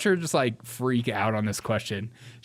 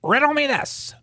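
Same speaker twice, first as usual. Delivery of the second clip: Riddler voice; 3 semitones lower, slower than usual. Pretend. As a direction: ham up a comic-book villain.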